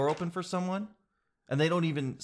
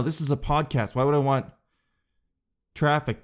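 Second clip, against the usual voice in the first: normal talking voice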